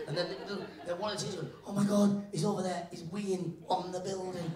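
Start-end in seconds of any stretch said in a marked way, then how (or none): 1.7-4.5 high-pitched horrified outcry; mock scandalised, starting loud; shocked teacher. Teacher spotting kid weeing on the school, shocked.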